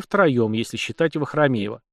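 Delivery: ordinary delivery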